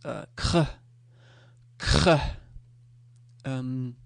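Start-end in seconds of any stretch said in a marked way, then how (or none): 0.4-2.2 a hard throaty "kr", the r scraped at the back of the throat; Danish kr. Shown as a demonstration. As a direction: demo the "kr" cluster, k and throaty r fused together. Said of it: "So the sound K R is".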